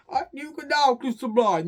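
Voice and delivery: loud, high announcement to the couple; mock wedding officiant. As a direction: proclaim it like a play-acted officiant.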